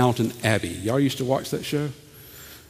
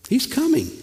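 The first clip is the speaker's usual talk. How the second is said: much higher he's coming; sudden excited cry; 13 semitones higher than usual. Acted. Preacher imagining Jesus arriving, sudden higher cry. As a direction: burst out as if you hear him. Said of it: "if you listen close enough".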